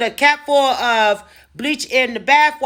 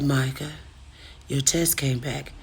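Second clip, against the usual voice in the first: grave quiet news; 7 semitones lower, 9 dB quieter than usual. Retold or imitated. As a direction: break it gently. Boss delivering test result.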